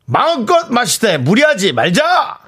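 high, slow rallying toast; drawn-out toast slogan; toast call. Shown as a demonstration. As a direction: proclaim it high and stretched out for the table. Calling out a toast.

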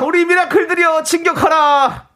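high, slow shouted war cry; rally the listeners like troops. Acted. Host echoes the battle cry.

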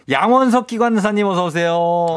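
bright, high-pitched greeting; excited welcome. Real, not acted. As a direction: welcome the guest with a lift. Host welcomes studio guest.

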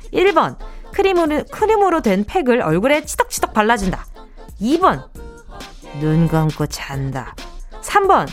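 upbeat home shopping pitch; hard sell ad. Acted. Radio shopping segment ad read.

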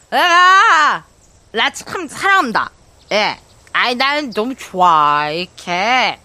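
put-on slurring drunk voice, high and loud; tipsy and giddy after one drink; acting drunk. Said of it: "막걸리 한 잔 먹고 이렇게 취한 연기하는 게".

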